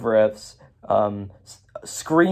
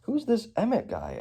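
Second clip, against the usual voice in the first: high, light mock-confused question; a hint of a blank stranger; softly high and clueless; puzzled friends; 9 semitones higher, 8 dB quieter than usual. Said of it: "everyone's just like".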